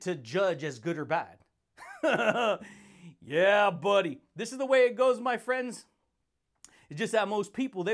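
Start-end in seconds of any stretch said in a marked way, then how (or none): 1.8-2.6 big laugh. Real, not acted. Laughs before catchphrase. 3.2-5.8 hyped catchphrase. Acted. Shouted catchphrase to viewers.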